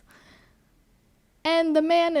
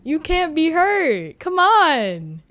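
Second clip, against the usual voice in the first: higher, louder exasperated plea; pleading with Irene; 7 semitones higher, 5 dB louder than usual. Retold or imitated. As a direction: urge her, come on. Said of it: "looking at Irene like".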